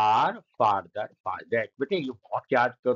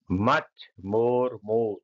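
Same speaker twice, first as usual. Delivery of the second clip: slow recitation; 3 semitones lower, much slower than usual. Shown as a demonstration. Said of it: "ये दोनों को एक जैसा".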